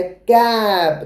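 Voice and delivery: very slow, stretched कैप; teacher stretching कैप slowly; model word. Shown as a demonstration. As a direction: draw out कैप for children to repeat. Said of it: "C A P क्या होता है".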